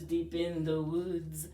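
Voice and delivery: usual speaking voice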